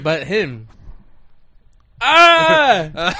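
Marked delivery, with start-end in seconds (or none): 2.0-3.1 high, loud drawn-out "Ah"; mock yell. Joking among friends about him, long mock yell. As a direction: let out a long comic yell.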